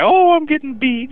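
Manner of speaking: high, loud mock wail; hammed-up tearful cry in falsetto; mock crying woman. Caller mimics a movie woman's complaint, mock wailing. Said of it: "You know, they cry".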